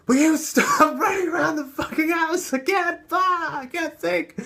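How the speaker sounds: high, loud, half-laughing mock yell of a frazzled mother; exasperated mom yelling. Mother scolding her running child, high loud mock yell with a laugh. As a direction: imitate mom losing it at the kid running around.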